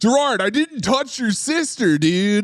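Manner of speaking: high mock Californian actor voice; Chris O'Donnell. Musketeer denying to Gerard he touched his sister, mock impression. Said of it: "[name] is doing a fucking spot on".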